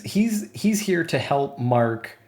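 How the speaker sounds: ordinary delivery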